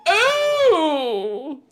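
high, loud gasping cry; startled mother's yelp; hammed-up shocked howl; howl of surprise. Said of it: "mother's howl of surprise".

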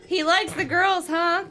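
a touch of mocking chuckle; chuckling aunt. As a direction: tease it like a leering aunt. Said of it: "still chuckling".

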